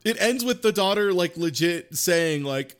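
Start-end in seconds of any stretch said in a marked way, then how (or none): none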